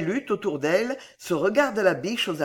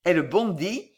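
plain reading voice

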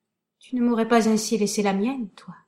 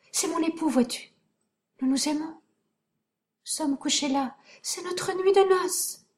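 delirious; 6 semitones higher than usual. Acted. Doña Sol in deranged denial.

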